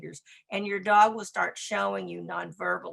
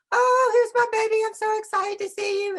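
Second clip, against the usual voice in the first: high, loud gushing greeting to the dog; gushing baby talk; 14 semitones higher, 6 dB louder than usual. Retold or imitated. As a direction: squeal over the dog like a doting owner. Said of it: "They come in and they go".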